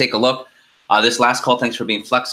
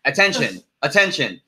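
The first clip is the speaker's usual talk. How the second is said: higher-pitched mock PA announcer; alarm announcement; 6 semitones higher than usual. Storyteller mimicking the hotel fire alarm, mock announcement. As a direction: imitate a recorded emergency announcement. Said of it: "there's a big announcement".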